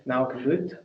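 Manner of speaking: ordinary talk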